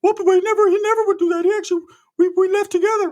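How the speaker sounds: very high, slightly loud cousin voice; Ricky. Retold or imitated. Cousin defending his friend who left, very high mock protest. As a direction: protest in a put-on falsetto. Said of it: "Ricky's like".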